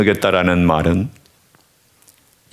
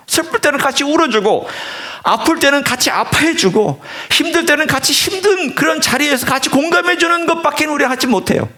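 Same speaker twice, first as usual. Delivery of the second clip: impassioned preaching. Acted. Preacher urging the congregation to feel the pain, impassioned. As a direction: preach it from the heart, rising high.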